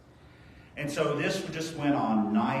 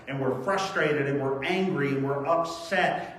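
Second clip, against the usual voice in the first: usual speaking voice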